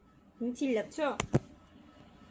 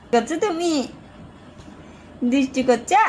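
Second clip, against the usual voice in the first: ordinary talk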